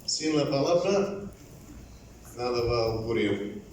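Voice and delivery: ordinary delivery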